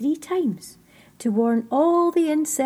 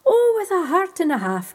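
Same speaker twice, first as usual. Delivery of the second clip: higher, louder glad welcome; cried out; 7 semitones higher, 6 dB louder than usual. Acted. Orfeo welcoming the freezing creatures, louder glad cry. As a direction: call out a hearty welcome. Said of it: "cried Orfeo".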